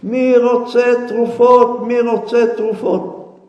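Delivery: loud, high street-hawker call; vendor shouting. Acted. Peddler hawking remedies to passers-by, shouting. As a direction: call out your wares to the whole street. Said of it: "וצועק".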